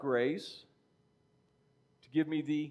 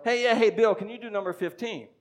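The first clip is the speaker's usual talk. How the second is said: higher, louder student calling out to the teacher; eager student; 7 semitones higher, 4 dB louder than usual. Retold or imitated. Math student calling to the teacher, eager and loud. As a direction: call out to get the teacher's attention. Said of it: "one of my students might go".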